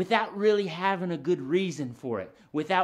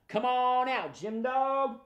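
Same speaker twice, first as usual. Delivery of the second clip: raised-pitch call, drawn out; big calling voice; 8 semitones higher, slower than usual. Shown as a demonstration. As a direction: call the puppet out for everyone to repeat. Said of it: "In a big, loud voice".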